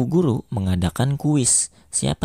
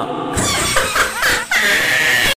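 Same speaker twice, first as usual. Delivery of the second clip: high laughter. Acted. Laugh track after punchline.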